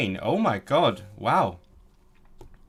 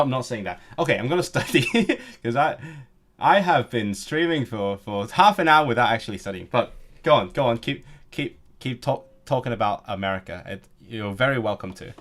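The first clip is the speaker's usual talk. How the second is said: ordinary talk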